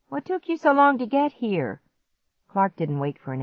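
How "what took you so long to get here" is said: high-pitched complaint; impatient question. A narrator's reading. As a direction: demand an explanation. Impatient brother questions late sister.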